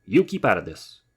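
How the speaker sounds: loud warning bark at Bear; shouted. Acted. Wolf shouting at Bear to stay out, loud. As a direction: tell her off loudly.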